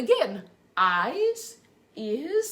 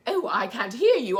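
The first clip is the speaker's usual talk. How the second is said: usual speaking voice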